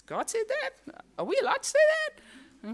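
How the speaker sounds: high, incredulous gasp of a startled reader; shocked reaction. Retold or imitated. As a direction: squeak it out as if caught off guard. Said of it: "you come across a passage of Scripture and you go".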